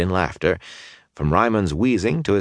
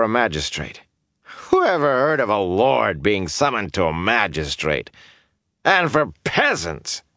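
tipsy indignant lord; 6 semitones higher than usual. A narrator's reading. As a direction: play a fuddled, huffy lord. Drunk lord Estienne indignant.

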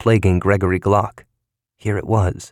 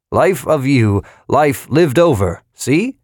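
put-on higher, slow, hearty elderly voice; jolly old fellow, drawn out; jovial old man; 6 semitones higher, slower than usual. A narrator's reading. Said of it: "a jovial, masculine, somewhat elderly voice".

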